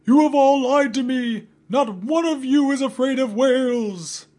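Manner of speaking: very high, loud betrayed scream; furious screaming at the lying crew. Acted. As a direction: shriek your betrayal as you fall. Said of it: "he screamed".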